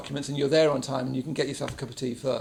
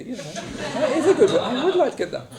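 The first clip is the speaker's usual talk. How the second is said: laughing; 9 semitones higher, 4 dB louder than usual. Real, not acted. Laughing at recommendations.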